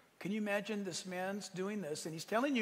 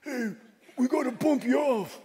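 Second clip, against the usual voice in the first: a hit man's jokey threat, higher and louder, laughing through it; mobster Guido; 6 semitones higher, 8 dB louder than usual. Retold or imitated. Preacher mimics a hit man about to kill him, mock gangster voice. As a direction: play the movie gangster, half laughing. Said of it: "it was like Guido".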